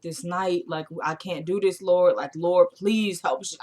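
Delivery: low, hushed mock prayer; murmured, barely hysterical pleading; subdued distraught plea. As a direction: imitate a quietly desperate plea to God. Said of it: "she's kinda hysterical, but not really".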